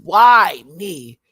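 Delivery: loud pleading cry; retold yell. Yelling at God in frustration, loud plea. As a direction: yell it up at the sky. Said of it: "when I'm screaming and yelling at him".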